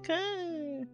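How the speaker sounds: ordinary talk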